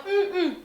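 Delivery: high, loud "mm, mm" of demand; imitated child's wordless grunt. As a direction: grunt for the phone like a toddler. Imita niño pidiendo el celular. (quejido agudo y fuerte de niño que pide)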